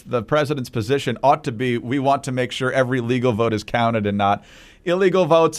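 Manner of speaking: normal talking voice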